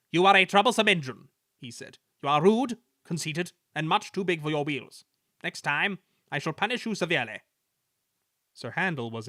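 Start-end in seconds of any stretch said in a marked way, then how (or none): 0.1-1.3 loud, high, rapid scolding; stern boss's reprimand; severe. A narrator's reading. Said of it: "was severe with Sir Handel". 2.2-7.4 higher, stern telling-off; stern controller's reprimand; severe. A narrator's reading. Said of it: "was severe with Sir Handel".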